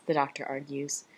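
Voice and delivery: ordinary delivery, nothing marked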